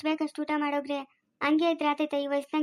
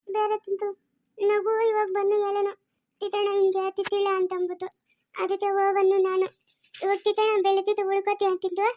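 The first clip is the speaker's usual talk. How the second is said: put-on higher-pitched childish lisp; lisping childlike character; 4 semitones higher than usual. Childlike character telling family about going along, lisping high voice. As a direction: play a childlike speaker with lisped words.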